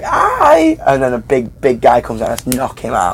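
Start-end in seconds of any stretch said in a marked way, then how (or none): 0.0-0.8 very high squeaky hi; a tiny kid greeting the crowd; tiny cute kid voice, squeaked. Pretend. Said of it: "is like".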